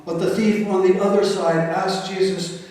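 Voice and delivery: ordinary delivery, nothing marked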